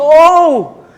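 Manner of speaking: loud high startled oh; startled gasp. Acted. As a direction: jump in fright. Story, dog sees snake.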